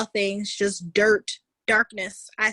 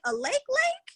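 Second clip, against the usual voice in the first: much higher, disbelieving double-take; incredulous. Retold or imitated. Repeating the friend's news, high and incredulous. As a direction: ask it in stunned disbelief. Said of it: "I was like".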